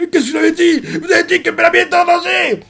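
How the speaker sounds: put-on high, triumphant drunk shout; excited drunk; told-you-so gloat. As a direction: rub it in their faces.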